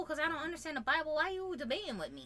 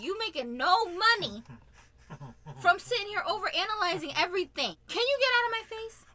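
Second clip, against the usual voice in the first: raised, mock-nagging complaint; imitated aggravated wife; 5 semitones higher, 6 dB louder than usual. Wife belittling her husband's income, mock aggravated. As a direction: build to a higher, louder fed-up demand, acted. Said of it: "She will get so aggravated".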